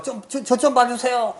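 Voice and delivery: Gyeongsang dialect call. Retold or imitated. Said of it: "경상도 사투리로 누가 막 얘기를".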